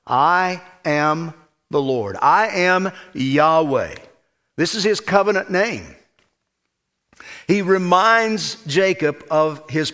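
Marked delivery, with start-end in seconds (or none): 0.1-4.0 slow, weighty declaration, each word set apart; emphatic God's voice. Retold or imitated. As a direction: speak as God declaring his name, unhurried. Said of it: "very emphatically, very clearly".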